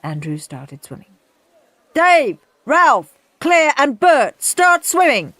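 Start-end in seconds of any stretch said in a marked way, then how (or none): 1.9-5.3 high, loud coach's bellow. A narrator's reading. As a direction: call the names across the pool. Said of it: "bellowed Soggy Sid".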